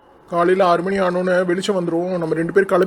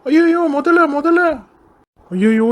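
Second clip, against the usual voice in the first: terrified cry; 10 semitones higher, slower than usual. Acted. Man spots a crocodile in the lotus pond, panicked cry. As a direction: shriek at the sudden crocodile.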